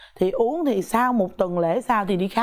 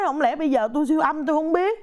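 normal talking voice

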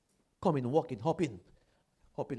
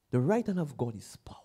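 fast breathless whisper; the preacher drops to a whisper about God's right hand; 5 semitones lower, 8 dB quieter, faster than usual. Real, not acted. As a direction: whisper it like a secret of power. Sudden hushed statement.

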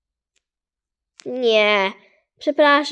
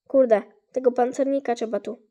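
usual speaking voice

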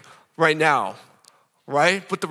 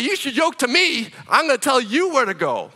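mock bossy defiance; 6 semitones higher than usual. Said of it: "that's what North America usually says".

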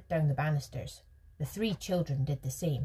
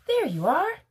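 high, loud exclamation; triumphant cry; 13 semitones higher, 9 dB louder than usual. A narrator's reading. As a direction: crow it in triumph. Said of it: "he cried triumphantly".

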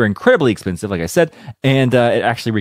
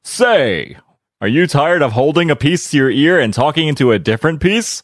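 hammed-up announcer selling a gadget; old-time radio ad voice; 5 semitones higher than usual. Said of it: "that's the ad you would read".

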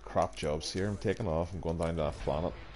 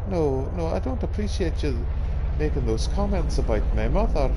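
mock indignant; 9 semitones higher, 9 dB louder than usual. Mock quarrel with station controller.

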